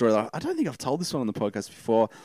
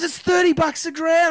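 Aussie bloke; 4 dB louder than usual. Retold or imitated. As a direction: ham up a freezing Aussie backpacker. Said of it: "and he goes".

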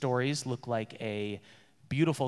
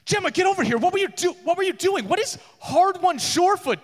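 high, loud scolding dwarf voice; Jaina scolding; 6 dB louder, faster than usual. Acted. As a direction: scold her, pitched way up. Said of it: "and she's like".

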